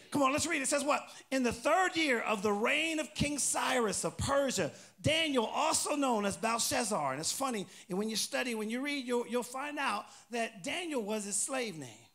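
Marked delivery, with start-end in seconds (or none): 1.3-7.1 loud, raised preacher's reading voice; emphatic, measured Bible reading; scripture reading. Acted. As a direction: proclaim the verse slowly to the congregation. Reads scripture aloud to congregation.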